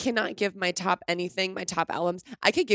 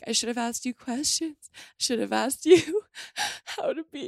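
crying through the lyric; 6 semitones higher than usual. Real, not acted. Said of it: "but she's like".

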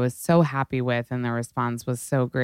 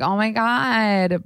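high, drawn-out "oh my God"; imitated reaction; 7 semitones higher, slower than usual. Retelling Khloe's reaction.

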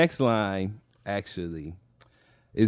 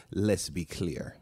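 put-on [name] accent; 4 semitones lower, 9 dB quieter, slower than usual. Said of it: "I do a job of whatever accent".

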